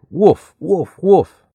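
high, short storybook woofs; imitated dog bark. A narrator's reading. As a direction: bark like an excited puppy in words. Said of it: "Pal barked at him".

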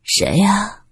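husky, wary call from inside; hoarse voice. A narrator's reading. Woman shut indoors asks who is at the door, hoarse. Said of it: "有一个沙哑的声音问道".

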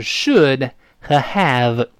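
"should" and "have" slowly, each word full and apart; slow, separated reading. Shown as a demonstration. Teacher showing learners the two words before linking them, slow and separated. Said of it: "分开读，慢慢读是".

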